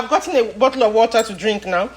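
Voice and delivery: ordinary delivery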